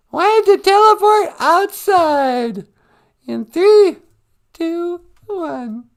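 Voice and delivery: very high, slow squeaky character voice; high-pitched sidekick. Pretend. Sidekick sweetie counting down the teleport, high squeaky voice.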